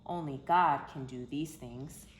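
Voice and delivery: usual speaking voice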